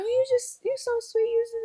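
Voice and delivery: high sugary mock-sweet voice; mocking people. Retelling how people fawned over her, high mock sweetness. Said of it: "people like".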